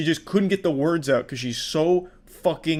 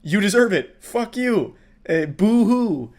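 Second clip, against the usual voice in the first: louder, higher jeering taunt; imitating a fed-up crowd mocking her; mock angry crowd; 7 semitones higher, 6 dB louder than usual. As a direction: sneer boo-hoo at her. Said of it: "And then people get mad at her like".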